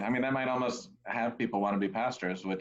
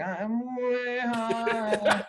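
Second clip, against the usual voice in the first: very slow, drawn-out and high; sliding the words; 12 semitones higher, 3 dB louder, much slower than usual. Retold or imitated. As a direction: stretch every word out, high. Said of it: "he'd be like, sliding the words".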